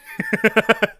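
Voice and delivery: squealing laugh; high shriek laugh. Real, not acted. Burst of laughter.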